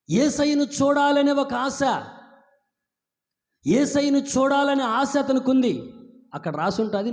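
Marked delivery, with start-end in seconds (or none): 0.1-5.9 high, loud preaching; fervent preaching. Acted. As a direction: proclaim it with fire. Preaching at full force.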